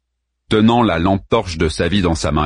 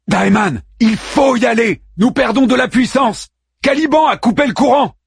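urgent shout; 4 dB louder than usual. A narrator's reading. Harman urging amid power loss.

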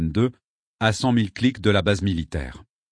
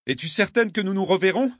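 raised-pitch call, no real volume; 9 semitones higher than usual. A narrator's reading. Traveller to the girl on the bank, calling out. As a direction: call the question anxiously across the rain. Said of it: "criai-je dans la pluie".

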